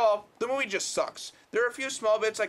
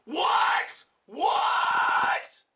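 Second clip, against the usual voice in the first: shocked outburst; 9 semitones higher, much slower than usual. Real, not acted. Reviewer learning the memories were erased, shocked shout. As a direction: yell in stunned outrage.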